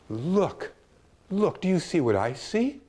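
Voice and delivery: high, eager calls to look; excited urging. Retold or imitated. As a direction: point at the sky and urge him to look. [name] excitedly points at sky.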